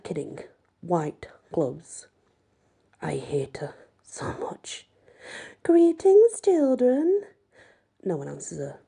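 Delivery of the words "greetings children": put-on high, bright formal greeting to the children; prim teacher voice. A narrator's reading. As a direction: sing it out like a prim 1950s hostess. Madame's first words to group.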